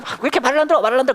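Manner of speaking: 꾸중 흉내. Retold or imitated. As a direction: replay a parent's exasperated nagging. 딸들을 나무라던 말 재연. (살짝 높은 목소리로 꾸짖는 흉내)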